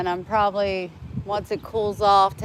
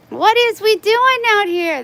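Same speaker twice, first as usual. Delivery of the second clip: high, loud coo at the cats on the doorstep; 13 semitones higher, 8 dB louder than usual. Real, not acted. Talking to her cats at the front door, high cooing baby talk. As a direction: gush at the cats like babies.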